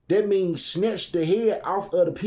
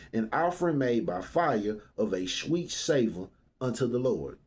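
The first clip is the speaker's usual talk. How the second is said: measured Bible reading, lower and softer; scripture reading; 5 semitones lower, 3 dB quieter than usual. Acted. Preacher reading scripture to the congregation, solemn reading.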